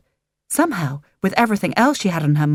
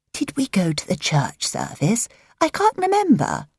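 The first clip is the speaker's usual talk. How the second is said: lightly voiced elderly aunt, rising to high; a hint of a vague old aunt; muddled old aunt; 5 semitones higher than usual. A narrator's reading.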